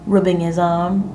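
ordinary delivery, nothing marked

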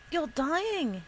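mild acted scream, pitched high; restrained narrated scream, falling at the end. A narrator's reading. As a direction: cry it out high without full volume. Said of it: "he screamed".

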